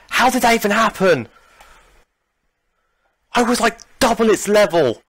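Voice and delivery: high, loud indignant outburst; outraged disbelief. Real, not acted. Raging over a lost battle.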